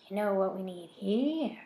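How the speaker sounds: slow, menacing creepy voice; horrible voice. A narrator's reading. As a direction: drag it out like a monster. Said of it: "in a horrible voice".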